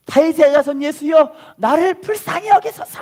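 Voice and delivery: high, loud pleading cry. Retold or imitated. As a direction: cry out to Jesus with all your voice. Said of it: "엄청 크게 소리 지르는 거예요". (큰 소리로 부르짖듯이)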